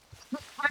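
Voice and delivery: high giggle burst; sudden squeaky laugh. Real, not acted. Streamer cracking up at her knife hunt.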